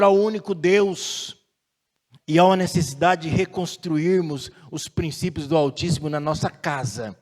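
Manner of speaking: ordinary talk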